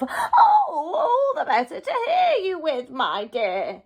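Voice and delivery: sly wolf as granny. Acted. Wolf answering Red about his ears, sly cackling granny voice.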